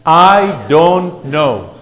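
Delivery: drawn-out, loud, coaxing lead; loud slow call-and-response; very slow group repeat. Shown as a demonstration. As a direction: lead the room through it slowly. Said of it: "Say it with me".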